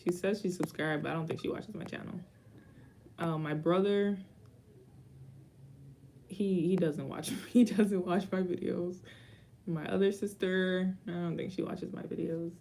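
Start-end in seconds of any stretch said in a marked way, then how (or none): none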